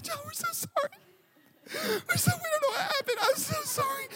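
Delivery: very high mock sobbing apology; imitated crying wife. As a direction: wail the apology like a tearful wife. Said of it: "And she's really crying".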